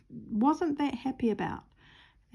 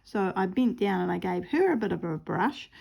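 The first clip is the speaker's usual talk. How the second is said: ordinary talk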